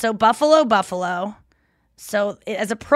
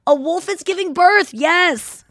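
excited agreement; 9 semitones higher, 5 dB louder, slower than usual. Real, not acted. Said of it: "It's so weird".

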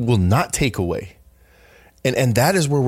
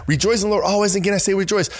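higher, bright and eager reading; with enthusiasm; 9 semitones higher, faster than usual. Shown as a demonstration. Showing listeners how to share the verse, higher and enthusiastic. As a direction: read it like real good news. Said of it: "have a little enthusiasm".

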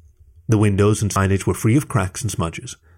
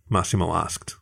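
plain reading voice

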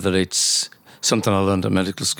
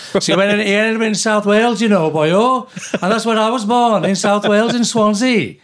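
put-on high, lilting Welsh accent; 10 semitones higher, 4 dB louder than usual. Doctor jokes about his father ending up in South Wales, Welsh accent.

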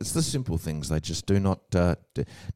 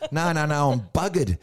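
higher, louder mock protest; emphatic correction; 10 semitones higher, 5 dB louder than usual. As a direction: hastily put the friend straight. Acting himself correcting friend again.

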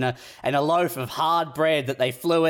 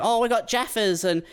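higher, lightly hammed-up national pride; mock Kiwi booster; 7 semitones higher than usual. Airline video selling Kiwi culture, mock-enthusiastic. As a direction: imitate an eager promo voice. Voices the in-flight culture pitch.

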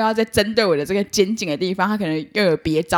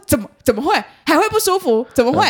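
mock disbelief; 6 semitones higher, 3 dB louder than usual. Masseur's surprised reply, retold.

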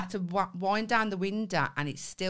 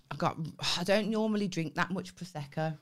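ordinary talk, nothing marked

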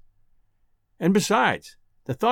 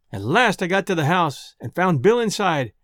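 ordinary delivery, nothing marked

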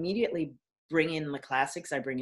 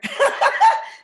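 laugh; 8 dB louder than usual. Real, not acted. Laughs at own boast.

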